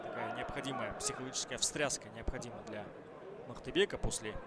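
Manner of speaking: normal talking voice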